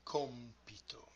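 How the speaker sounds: soft, careful repeat of "Compito", front stress; stress on third-last syllable. Shown as a demonstration. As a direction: gently lean on the "Com" of "Compito". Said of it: "stress goes on the third to the last".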